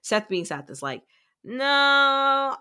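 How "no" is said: long, high, loud no; sing-song mock denial; stubborn refusal. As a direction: imitate a childish drawn-out refusal. Acting Seth refusing the advice.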